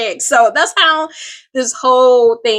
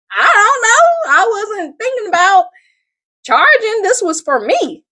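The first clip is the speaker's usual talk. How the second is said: caught off guard; 12 semitones higher, 3 dB louder than usual. Retold or imitated. Asked what she charges, high and caught off guard. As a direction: squeak out an unready answer. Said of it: "And I'm like".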